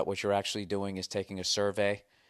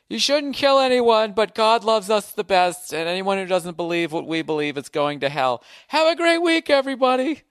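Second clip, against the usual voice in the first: preachy zealot voice; 10 semitones higher, 6 dB louder than usual. Acted. Comedian mocking the church crowd, high sanctimonious preacher voice. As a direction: sing out the smug holy sermon. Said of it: "every character in my fucking act talk like that".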